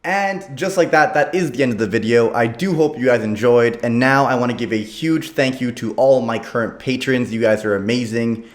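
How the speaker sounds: loud upbeat YouTube outro. Acted. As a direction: project it like an outro.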